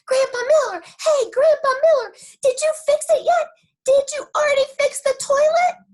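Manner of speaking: high, loud shout to Grandpa; excited call. Acted. Junie B shouting to Grandpa running up. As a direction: call out across the garden.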